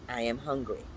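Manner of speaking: usual speaking voice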